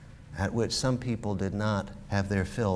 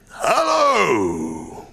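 loud, high, drawn-out bellow; feigned growl; 8 semitones higher, 7 dB louder than usual. Acted. Scrooge pretending his old self to Bob, mock growling. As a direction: bellow it like a grumpy boss putting it on. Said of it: "growled Scrooge in his accustomed voice, as near as he could feign it".

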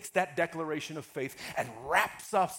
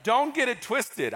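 usual speaking voice

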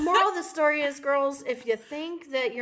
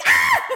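short loud yelp of shock; mock startled scream; 11 dB louder than usual. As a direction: imitate being jolted out of sleep. Retold startled scream on waking.

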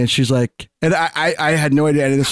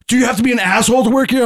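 higher, faster huffy voice; mock indignant retort; 8 semitones higher, faster than usual. Guy snapping at his friend about the bartender, higher and huffy. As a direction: fire it back offended and quick. Angry guy's retort quoted.

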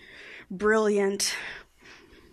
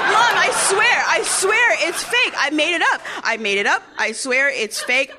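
loud, high start, easing as it repeats; desperate pleading; 6 semitones higher, 5 dB louder than usual. Retold or imitated. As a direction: plead frantically like a kid caught out. Child desperately pleads with mother.

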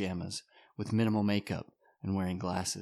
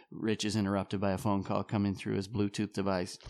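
ordinary talk, nothing marked

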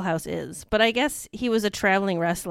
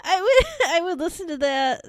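laughing; 8 semitones higher, 3 dB louder than usual. Real, not acted. Laughing while talking.

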